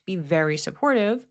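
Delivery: normal talking voice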